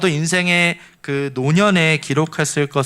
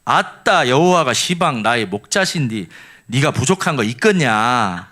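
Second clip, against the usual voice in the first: Jeolla dialect; 2 semitones lower than usual. Shown as a demonstration. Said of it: "이제 전라도 버전은요".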